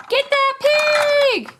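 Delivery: high, slow, drawn-out pup cry; cartoon pup shout. Chase urging the chase, a high drawn-out pup cry. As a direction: call it out like an excited little puppy.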